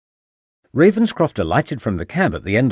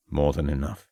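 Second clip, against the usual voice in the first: low male voice; 6 semitones lower, 5 dB quieter than usual. Acted. The man answers.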